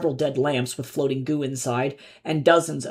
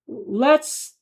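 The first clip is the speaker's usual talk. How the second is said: a high, halting first try; stammered start; 9 semitones higher than usual. A narrator's reading. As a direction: start and stop, unsure. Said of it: "he stammered".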